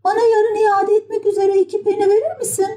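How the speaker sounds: very high, eager puppet plea; Pinokyo. A narrator's reading. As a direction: ask boldly in a tiny high voice. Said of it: "Meraktan çatlayan Pinokyo ... utanmadan". (utanmadan isteyen tiz kukla sesi)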